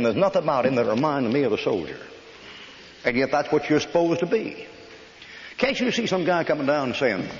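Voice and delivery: ordinary talk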